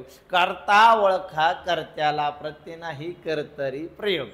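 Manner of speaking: stretched-out rule, sinking toward the end. Shown as a demonstration. Same rule repeated slowly for class.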